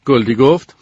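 ordinary delivery

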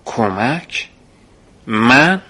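disbelief building; surprised. Acted. Boy surprised he is asked to help, astonished. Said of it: "متعجب پرسیدم".

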